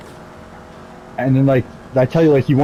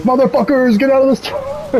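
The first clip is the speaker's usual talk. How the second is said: high mock yell at customers; hammed-up boss shouting people out; mock manager yell; 13 semitones higher, slower than usual. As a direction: pretend manager hollering into a megaphone. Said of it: "so what if he was like".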